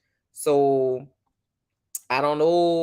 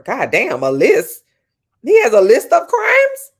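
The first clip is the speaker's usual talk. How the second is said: rising to a high, loud, slowed shriek; floored that there's a whole list; stunned outrage; 6 semitones higher, 11 dB louder, slower than usual. Real, not acted. Reacting to her chat's news of his crimes, shocked shriek.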